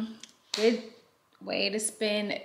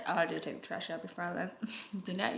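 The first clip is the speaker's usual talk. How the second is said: usual speaking voice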